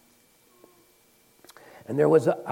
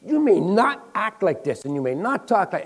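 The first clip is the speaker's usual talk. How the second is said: raised, higher voice laying down the law; firm rebuke; 8 semitones higher, 6 dB louder than usual. Retold or imitated. Liz confronting the micromanager, firm and raised. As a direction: draw a hard line, louder and higher. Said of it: "drew a line in the sand".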